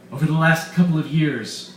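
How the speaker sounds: loud, gloating mad scientist voice; villain Weiss. Acted. Doctor Weiss gloating to his captives, loud and smug. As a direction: boast like a scheming villain.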